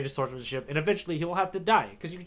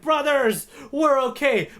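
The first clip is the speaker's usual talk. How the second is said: cheerful mock reunion; 10 semitones higher, slower than usual. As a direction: call out happily to the brothers, pitched high. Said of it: "they're like".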